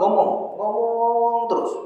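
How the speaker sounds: long drawn-out high "ngomong"; "ngomong" dragged out high to mimic talk that won't stop; endless nagging talk. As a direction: stretch the second "ngomong" on one high held note. Wife's endless talking acted out.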